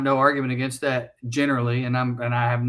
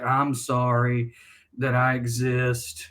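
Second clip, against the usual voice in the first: slow, apologetic mumble; 3 semitones lower, slower than usual. Retold or imitated. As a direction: say it as if ashamed of existing. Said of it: "almost like".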